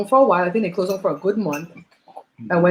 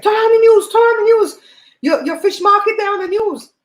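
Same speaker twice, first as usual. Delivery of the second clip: high-pitched urgent phone yell, imitating dad; urgent calling out; 13 semitones higher, 5 dB louder than usual. Retells dad's urgent phone call.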